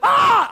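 loud high startled yelp; startled yell. Retold or imitated. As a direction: yelp like you nearly crashed. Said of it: "I always yell".

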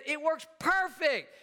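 high, drawn-out excited boast; excited young son. Retold or imitated. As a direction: imitate a thrilled kid, slow and high.

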